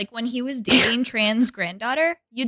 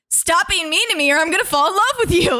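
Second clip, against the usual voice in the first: high, pleading mock voice; lightly voiced sappy plea; mock lovesick plea; 6 semitones higher than usual. Friend imitating Nick pleading with the angry lady, high mock plea. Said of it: "And he's like".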